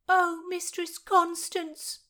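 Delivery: high, slow, loud wail; wailing maid. Acted. Maid wails to her mistress in misery, high slow wail. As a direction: draw out the cry in despair.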